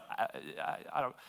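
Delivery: frightened stammer. Retold or imitated. Frozen lieutenant stammers his answer.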